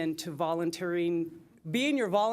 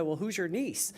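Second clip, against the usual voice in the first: amused quote. Retold or imitated. Retelling meeting the aunt.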